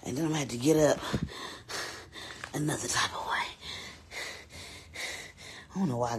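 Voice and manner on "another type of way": whispered. Real, not acted. Struggling to get up.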